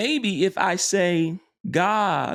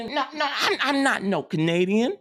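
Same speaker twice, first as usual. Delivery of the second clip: put-on higher kid voice with a laugh, digging in; stubborn child; 7 semitones higher than usual. Pastor voicing a stubborn child, laughing in a higher kid voice. Child insisting in acted dialogue.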